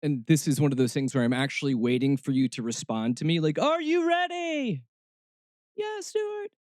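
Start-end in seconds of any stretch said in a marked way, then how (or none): 3.6-4.8 high, drawn-out hype-man call; hyped-up call. Pretend. Host rousing listeners for a response, hyped-up call. 5.8-6.5 tiny, very high soft falsetto; mock audience reply. Audience answering the host on cue, mock squeaky reply.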